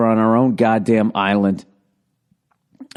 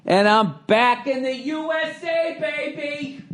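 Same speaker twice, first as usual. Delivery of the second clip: high, slow, drawn-out cheer; triumphant homecoming cry; 12 semitones higher, 4 dB quieter, slower than usual. Pretend. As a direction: belt it out like a stadium announcer. Cheering his return home.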